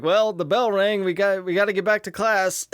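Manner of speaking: hurried fake-innocent kids; kids scattering. Kids fleeing the pole.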